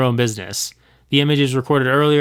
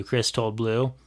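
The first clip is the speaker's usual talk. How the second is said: ordinary delivery, nothing marked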